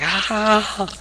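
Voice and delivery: high pained groan; mock groan. Austin passing out in the hold, groan. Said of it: "and he's like".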